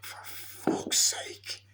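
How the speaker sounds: slow, drawn-out whispered curse after a sigh; exasperated whisper. Retold or imitated. Reviewer despairing at Leatherface's face shown, exasperated whisper. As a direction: whisper it in weary disbelief.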